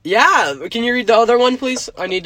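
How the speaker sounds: high, loud eager asking. Real, not acted. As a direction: urge them on excitedly. Eager to hear the next note.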